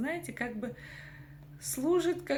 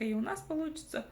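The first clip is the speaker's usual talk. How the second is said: usual speaking voice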